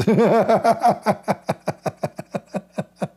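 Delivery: giggling high; high giggle; high laugh. Real, not acted. Laughing at sneezing.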